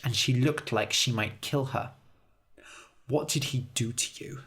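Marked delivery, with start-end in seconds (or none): 3.1-4.4 soft, hurt question; quiet. A narrator's reading. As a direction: ask it softly. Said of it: "she asked quietly".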